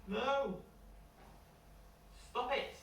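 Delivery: high sharp protest; real scolding. Real, not acted. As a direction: stop them, half laughing. Reader scolds someone interrupting.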